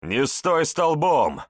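loud, slow urgent shout. Acted. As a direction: yell it right in his ear. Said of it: "Крик Сергея у самого уха".

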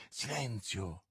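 low, very soft warning; hushing. Acted. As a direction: hush her with an urgent undertone. Bonacieux hushes Milady, someone coming.

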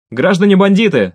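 loud, high official call; narrated shout to a distant group; shouted address. A narrator's reading. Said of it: "закричал Микола".

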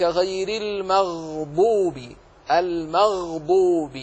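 slow recitation holding the vowelless gh in "المغضوب"; clear still ghayn. Shown as a demonstration. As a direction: draw out "المغضوب" as al-magh-dubi. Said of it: "احرص على سكون كل حرف ساكن". (ترتيل مع بيان سكون الغين في "المغضوب")

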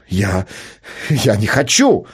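usual speaking voice

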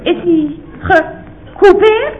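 "gecoupeerd" drawn out slowly, syllable by syllable; patiently repeated question, very slow; slow, spelled-out question. Acted. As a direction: say it slowly for someone who didn't get it.

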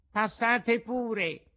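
high, slow drawn-out call; carpenter calling out. A narrator's reading. Weakened carpenter answers a knock at the door, high drawn-out call. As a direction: invite them in, high and drawn out.

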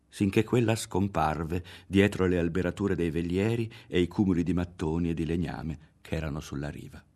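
ordinary delivery

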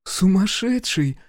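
high, drawn-out shocked exclamation; aghast gasp. A narrator's reading. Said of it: "ахнула Анька". (ахнув, высоко и протяжно)

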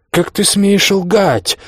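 high, drawn-out outrage; indignant accusation. A narrator's reading. Drunk husband accuses his wife of lying, high and indignant.

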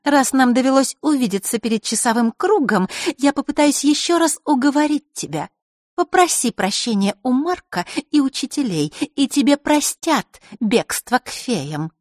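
put-on persuasive girl. A narrator's reading. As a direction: try to talk him round. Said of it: "вкрадчиво начала Маришка".

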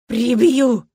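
loud, rolled growl of fury; furious growl; growled threat. A narrator's reading. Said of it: "прорычала".

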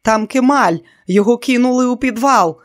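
desperate shrieking cry. A narrator's reading. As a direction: scream the news in terror. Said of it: "верещала Дейна".

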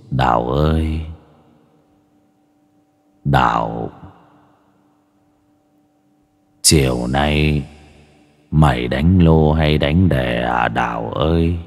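low, slow ghostly voice; demon voice. Acted. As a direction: call out like a ghost from the trees. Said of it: "giọng ma quỷ thì vẫn như kia". (giọng ma quỷ trầm, chậm)

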